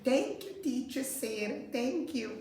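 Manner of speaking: put-on high, singsong child voice; high-pitched classroom thank-you; child saying thanks. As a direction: lightly mimic a pupil's polite thanks. Models English thanks as pupil.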